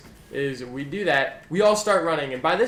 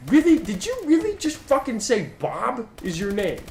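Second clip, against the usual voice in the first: mock disbelief; 8 semitones higher, 4 dB louder than usual. Mocking his own lie.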